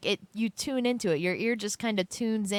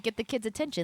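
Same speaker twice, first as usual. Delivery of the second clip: usual speaking voice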